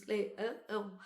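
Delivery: "leão" in three pieces, le-ã-ão; split in three. Shown as a demonstration. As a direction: segment "leão" with an extra nasal beat. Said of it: "o leão tem três pedacinhos".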